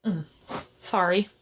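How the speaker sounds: ordinary talk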